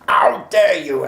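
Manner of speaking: high, loud, slow mock yell of rage; angry screaming. Deputy head screaming at the charisma master, imitated angry shout. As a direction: belt it out like an outraged teacher. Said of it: "the almightiest screaming and shouting".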